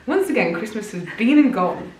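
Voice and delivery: high, dramatic reading voice; diary reading voice. Shown as a demonstration. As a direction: read it like a diary entry. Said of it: "you're just reading the way you write".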